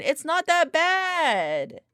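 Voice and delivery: slow, stretched it's not that bad; exaggerated slow protest; drawn-out protest. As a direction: drag every word out in protest.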